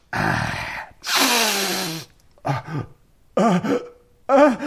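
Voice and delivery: halting breaths before a sneeze; building up to sneeze. Acted. Character takes a pinch of snuff, gasping toward a sneeze. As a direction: let the sneeze gather, gasp by gasp.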